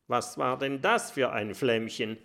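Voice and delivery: ordinary delivery, nothing marked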